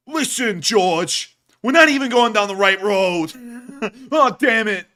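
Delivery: hammed-up New Yorker griping. Tank crewman in New York accent scolding George about the road. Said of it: "And you had the New Yorker voice".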